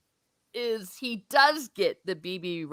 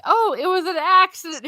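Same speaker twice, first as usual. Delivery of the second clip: mock innocent wife; 8 semitones higher, 5 dB louder than usual. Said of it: "she's like".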